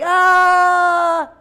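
high, long loud "aah" scream; imitated yell. Teacher acts out the yelling boy picture, high loud yell. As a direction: demonstrate a big playful yell. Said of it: "He's yelling".

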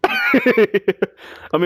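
laughing through the words; high laugh breaking into speech. Real, not acted. Laughs while playing.

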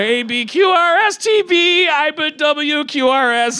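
loud, high-pitched mangled ABCs; mock alphabet recital. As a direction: belt out letters like a three-year-old teacher. Mimics niece's garbled alphabet lesson.

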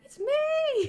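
calling from afar. Acted. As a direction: shout down from a great height. Shouting down to aunt.

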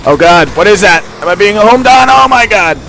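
high, loud, rising panic; real gamer panic, climbing in pitch; alarmed shout. Real, not acted. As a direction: shout in mounting alarm at an attack. Alarmed by attack in game.

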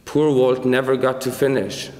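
ordinary delivery, nothing marked

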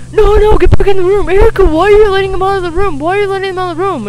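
loud, high imitation of an irate brother; mock angry yelling. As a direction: rattle off a scolding, imitating his outburst. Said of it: "he was getting pissed off at me".

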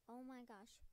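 normal talking voice

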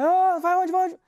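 loud, high call; shouting. Retold or imitated. Policeman shouting at the boy leaving. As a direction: yell demanding where he's going. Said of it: "Aí".

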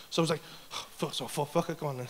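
low, quiet resigned grumble; resigned mutter. Retold or imitated. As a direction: mutter it under your breath, giving in.

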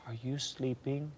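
softly voiced, imitating a mother's whisper; hushed mother's whisper. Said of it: "And so she whispered".

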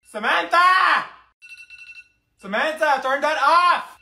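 loud, high yell across the house; angry yell. Acted. Sister yelling to turn off the alarm. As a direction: scream the name over the alarm.

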